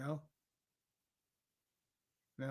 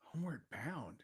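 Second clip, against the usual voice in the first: incredulous whisper; 7 dB quieter than usual. Real, not acted. Disbelieving whisper of the title.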